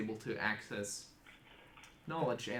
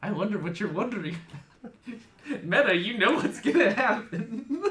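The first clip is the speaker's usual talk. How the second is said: laughing loudly while talking; loud, high, laughing through words; 9 semitones higher, 13 dB louder than usual. Real, not acted. GM laughing in character.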